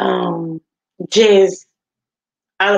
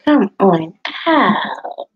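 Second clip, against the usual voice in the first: drawn-out, playful calling-out; coaxing call; 5 semitones higher, slower than usual. Real, not acted. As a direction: coax them out, stretching the words. Coaxing listeners to join the panel.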